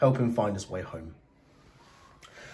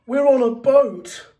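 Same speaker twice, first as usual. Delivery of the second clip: higher, louder, slow crushed soapbox; 12 semitones higher, 6 dB louder than usual. Acted. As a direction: drag it out like a squashed box.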